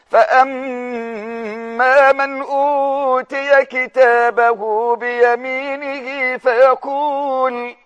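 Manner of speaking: very slow, drawn-out chanted recitation; Quran recitation. Acted. Preacher reciting the verse to his viewers, slow chant. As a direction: recite it with tajweed, stretching every vowel.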